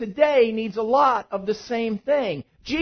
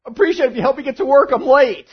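rushed plea; 5 semitones higher, 6 dB louder, faster than usual. Retold or imitated. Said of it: "And I said".

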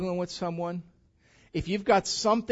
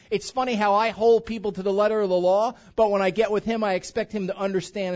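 preaching loud; 4 semitones higher, 7 dB louder than usual. Real, not acted. Preacher building intensity.

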